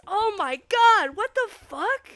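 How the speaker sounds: loud, high startled cry; shocked outburst. Real, not acted. Streamer startled by surprise guest in chat, loud shock. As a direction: jump out of your seat in shock.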